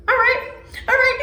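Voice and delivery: high-pitched mock agreement; obliging villain. Villain answers the kid's plea, mock obliging. Villain's offhand reply, retold.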